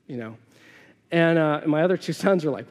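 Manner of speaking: ordinary talk, nothing marked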